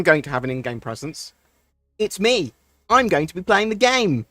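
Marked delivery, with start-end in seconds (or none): 2.0-4.3 higher, louder nerd rage rant; a mock indignant gamer outburst. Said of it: "went into my, my nerd rage voice".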